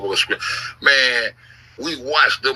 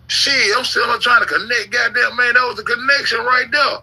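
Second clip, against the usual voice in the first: much higher pitch, the excitement carrying on; still excited; 10 semitones higher than usual. Real, not acted. Telling the audience about the connection, high and excited. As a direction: still buzzing from the discovery. Still worked up over discovery.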